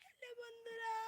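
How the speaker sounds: high, soft tearful whine; mock crying. Streamer greets absent viewers, mock crying. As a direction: greet them in a small hammed-up wail. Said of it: "কেঁদেই দিলাম".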